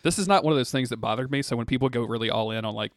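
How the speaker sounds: ordinary talk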